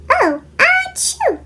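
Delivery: high mock sneeze "ah-chew". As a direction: sneeze it out comically.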